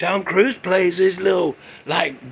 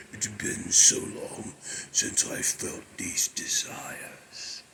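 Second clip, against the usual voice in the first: much lower, slow, unvoiced demon whisper; Darkness demon voice; 12 semitones lower, 11 dB quieter, slower than usual. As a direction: purr it like a seductive devil. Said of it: "he said that in there".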